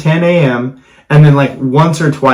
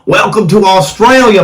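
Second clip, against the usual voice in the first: half-hearted Australian greeting; failed Australian accent; 5 semitones higher than usual. Shown as a demonstration. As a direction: try an Australian accent and miss. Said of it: "I can't do an Australian".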